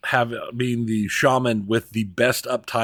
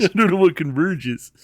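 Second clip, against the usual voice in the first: laughing mimicry; 7 semitones higher than usual. S1 laughing, repeating the quote.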